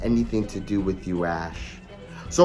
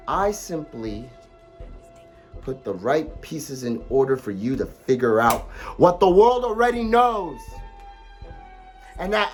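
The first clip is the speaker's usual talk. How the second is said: usual speaking voice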